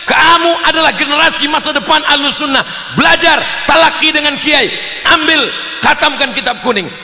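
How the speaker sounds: high, forceful fatherly exhortation; rousing pep talk. Acted. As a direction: act a father rallying his child. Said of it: "saya beri semangat". (seruan ayah yang menyemangati anak)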